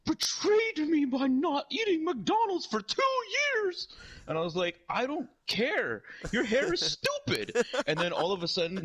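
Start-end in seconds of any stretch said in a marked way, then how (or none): 0.0-3.9 slow, high, melodramatic accusation; hammed-up betrayed clown wail; Ronald McDonald. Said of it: "And he's like". 4.9-7.6 slow, high-pitched acted insult; exasperated retort. Acts out retort in fight.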